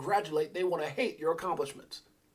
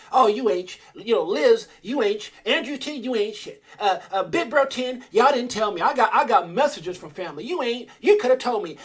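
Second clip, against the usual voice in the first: raised, louder mock scolding voice; mocking family complaints; 5 semitones higher, 7 dB louder than usual. Retelling family's complaints, mock scolding voice. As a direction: jab back at him like the nagging relatives. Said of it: "Misery loves company".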